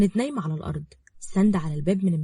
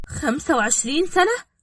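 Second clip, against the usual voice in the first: shocked; 8 semitones higher, 3 dB louder than usual. Acted. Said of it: "رقية بصت لها بصدمة".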